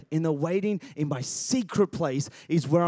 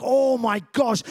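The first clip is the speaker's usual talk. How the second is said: higher and much louder cry of wonder; awed exclamation; 5 semitones higher, 7 dB louder, slower than usual. Retold or imitated. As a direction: gasp it out, overwhelmed. Disciples awed on the mountain.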